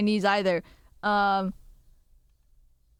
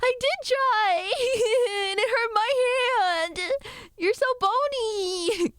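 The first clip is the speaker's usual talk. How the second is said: high, drawn-out tearful whine; mock-crying protest in a squeaky, stretched voice; whiny protest; 12 semitones higher than usual. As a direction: wail it like a hammed-up crybaby. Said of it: "And I'd be like".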